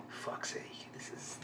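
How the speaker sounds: whispered under the breath; hushed exasperated swear; whispered curse. Real, not acted. Reacting tensely to the scene.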